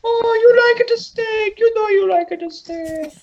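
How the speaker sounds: put-on very high, slow, comic Italian accent; hammed-up Italian accent. Comic Phantomax offering the dog a steak, hammed-up Italian accent. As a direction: tease in a drawn-out, falsetto fake Italian accent. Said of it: "It was Italian".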